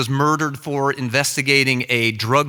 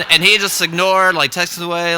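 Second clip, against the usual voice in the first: plain reading voice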